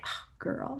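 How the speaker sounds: breathless whisper of a wry reaction; whispered aside. Retold or imitated. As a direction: breathe it out, half to yourself. Reacting to a friend's advice.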